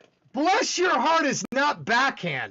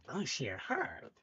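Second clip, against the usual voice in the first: condescending; 10 semitones lower, 13 dB quieter, slower than usual. Shown as a demonstration. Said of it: "anything could be said condescending".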